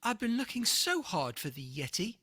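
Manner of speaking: relieved and thrilled; excited boy. A narrator's reading. George greets Nettie as the Yeti, eager and loud. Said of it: "shouted George".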